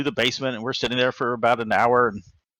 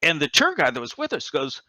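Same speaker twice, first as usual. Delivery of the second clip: ordinary delivery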